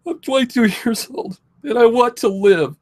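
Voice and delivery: high, crying voice, real distress; tearful plea. Real, not acted. Young patient tells viewers they want to live, tearful.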